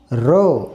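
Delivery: "र" as ruh, not ar; phonic sound of R. Shown as a demonstration. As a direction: sound out "र" low, with a neutral vowel. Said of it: "आरचा उच्चार".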